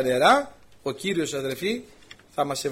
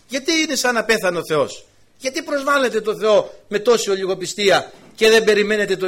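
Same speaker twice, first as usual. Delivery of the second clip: raised, high preaching voice, question after question; impassioned rebuke; 4 semitones higher, 4 dB louder than usual. Acted. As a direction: challenge the mourners' lack of faith forcefully. Acted rebuke of the mourners. (έντονη, υψωμένη φωνή επίπληξης)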